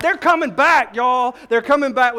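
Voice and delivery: high, loud triumphant cry; joyful shout. Real, not acted. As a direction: shout the good news to the church. Preacher proclaiming the resurrection.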